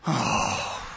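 low exasperated sigh; withering sigh. Retold or imitated. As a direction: sigh it out, fed up. Imitating Jesus's deep sigh.

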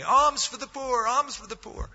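high-pitched plaintive beggar's cry; a pleading beggar's call at the city gate. Acted. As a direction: call out for alms like a street beggar. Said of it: "he was begging every day".

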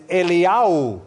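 Eliyahu said the Hebrew way, el-ee-YAH-oo; Hebrew pronunciation. Shown as a demonstration. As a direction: say "Eliyahu" as in Hebrew, not English. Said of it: "The Hebrew pronunciation of Elijah is".

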